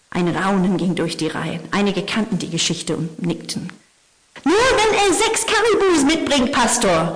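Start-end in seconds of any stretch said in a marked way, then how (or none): 4.4-7.1 put-on much higher, louder heckling young man; young man calling out. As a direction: call it out from the back like a cocky young listener. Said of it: "rief ein junger Mann".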